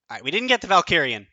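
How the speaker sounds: usual speaking voice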